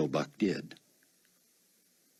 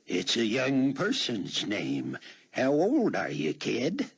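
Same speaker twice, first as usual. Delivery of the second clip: put-on slow, higher-pitched old-man voice; husky old man; 7 semitones higher, 3 dB louder, slower than usual. A narrator's reading. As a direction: put on an old codger, drawing the words out. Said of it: "He slipped into an old man's husky voice".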